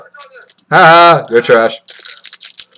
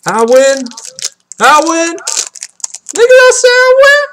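builds from a cheer to a high shrieking "I win!"; gloating shout; 10 semitones higher, slower than usual. Real, not acted. Card pack opener gloats at his viewers, escalating excited shouts. Gloating over pulling good cards.

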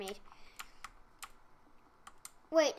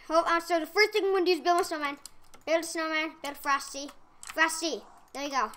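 ordinary talk

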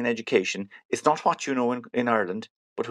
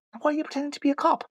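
incredulous question; faster than usual. Acted. Driver questions the fake cop.

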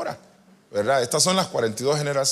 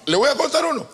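excited announcement; 11 semitones higher, 4 dB louder than usual. Real, not acted. Announcing a story, voice jumps up.

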